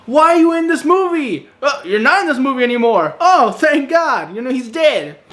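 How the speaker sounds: dump all your annoyance on him; exasperated. Retold or imitated. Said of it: "He's like".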